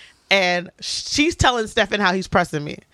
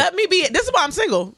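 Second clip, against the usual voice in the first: high, fed-up complaint; exasperated protest; 7 semitones higher than usual. Real, not acted. Storyteller to teasing co-hosts, exasperated. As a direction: plead to be left alone, pitch raised.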